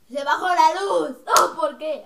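loud, drawn-out outcry; exclaiming. Real, not acted. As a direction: cry out in loud dismay. Exclaims as the light drops.

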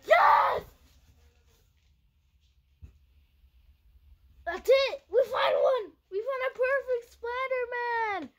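loud, high victory yell; screaming with joy at the perfect popsicle; triumphant shout. Real, not acted. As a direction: celebrate like you won.

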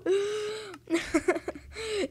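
high, helpless laughter after a scream; long laugh trailing off; laughing fit. Real, not acted. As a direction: crack up at your own mock death. Cracks up after the mock death.

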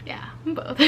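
whispered repeat of the confession; whispered aside. Real, not acted. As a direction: drop to a whisper as if confiding. Repeats it under breath.

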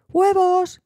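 put-on a high, loud child's cry; shouting with glee; excited shout. A narrator's reading. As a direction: call out the find to everyone. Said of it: "gritó Ruthie".

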